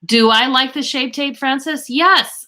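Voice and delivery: high, bright eager answer; light up answering the question; excited. Real, not acted.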